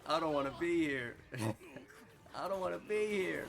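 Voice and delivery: mock whiny hero voice, laughing through it; whiny Endeavor. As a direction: moan it like you want to leave. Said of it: "That Endeavor was like".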